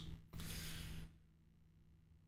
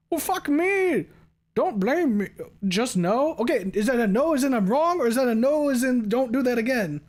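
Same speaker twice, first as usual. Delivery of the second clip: flustered; 10 semitones higher, 5 dB louder than usual. Real, not acted. Flustered after chat says no.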